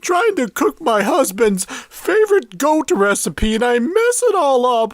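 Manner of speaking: high housewife voice. Acted. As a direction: gush like a 1950s ad housewife. Housewife testimonial in ad.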